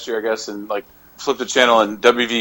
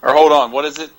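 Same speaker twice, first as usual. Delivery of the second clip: usual speaking voice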